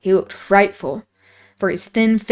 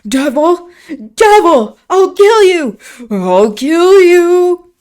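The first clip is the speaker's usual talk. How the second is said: high, very loud screaming curse; frantic shriek; 9 semitones higher, 9 dB louder, slower than usual. A narrator's reading. Linton raging at the locked door, high loud shriek. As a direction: screech it in powerless fury. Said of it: "he shrieked so shockingly".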